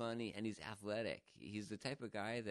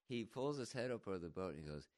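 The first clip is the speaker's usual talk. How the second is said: ordinary delivery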